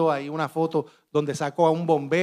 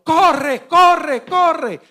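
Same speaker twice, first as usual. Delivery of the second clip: much higher, much louder retold shout; shouted warning; 11 semitones higher, 9 dB louder, much slower than usual. A voice in the street urging people to flee, loud higher retold shout. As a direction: yell it like someone warning a crowd to run.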